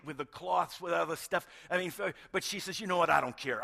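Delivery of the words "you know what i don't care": a touch of defiant anger, louder; mad. Retold or imitated. Mary tells the gardener she will carry the body, defiantly. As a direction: push it out, fed up and stubborn. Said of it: "I think she was mad enough".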